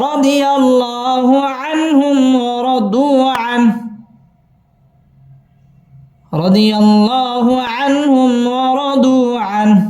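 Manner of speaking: slow, drawn-out melodic tilawah, high and sustained; Quran recitation. Shown as a demonstration. Teacher reciting the verse to students, slow melodic tilawah. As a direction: chant each word long and lilting like a qari.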